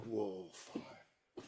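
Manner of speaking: very soft, low murmur; hushed retold letdown; deflated reaction. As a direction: let the voice sink away.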